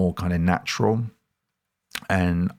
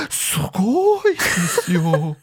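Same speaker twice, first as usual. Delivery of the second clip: high gasping amazement; gasp, then gush in awe; amazed TV assistant; 11 semitones higher than usual. Retold or imitated. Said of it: "she has this, like, look on her face".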